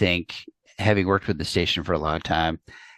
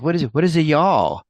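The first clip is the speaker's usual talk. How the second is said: put-on higher, puzzled kid asking what y'all means; mock bafflement; 9 semitones higher, 4 dB louder than usual. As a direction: play the lost New York kid, lightly baffled. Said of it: "I was like".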